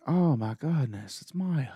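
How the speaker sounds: lightly imitated delight, drawn out and rising; slow, mock-overjoyed exclamation; happy relief. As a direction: act out a happy sighting with a high lift. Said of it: "he's so happy".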